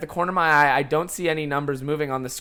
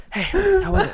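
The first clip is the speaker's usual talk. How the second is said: mock friend voice; 4 dB louder than usual. Friend voiced, cheerful after set.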